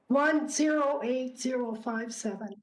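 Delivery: normal talking voice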